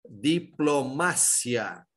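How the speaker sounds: slow "diplomácia" broken into syllables, di-plo-MA-sya; stress on MA. Shown as a demonstration. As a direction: spell out "diplomácia" by syllable, leaning on MA. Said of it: "Qual é a minha sílaba forte?". ("diplomácia" silabada, com tônica no MA)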